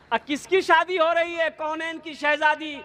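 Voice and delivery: high, sustained oratorical pitch; exalting preaching. Acted. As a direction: proclaim the bride's rank to the crowd. (ऊँचे सुर में जोशीला बयान)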